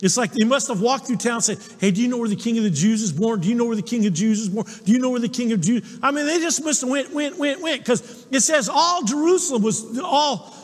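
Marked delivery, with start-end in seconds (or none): 1.8-6.0 quick-fire imitated questioning; fast, insistent mock inquiry; rapid repeated asking. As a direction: rattle it off like asking every passerby. Wise men asking all over town.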